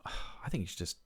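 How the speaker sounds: sighed whisper. Real, not acted. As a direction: breathe it out with a sigh. Exasperated reply to question.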